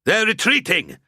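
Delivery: high, loud call to all; loud announcement. A narrator's reading. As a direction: announce it across the field. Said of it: "Ansbach announced at last".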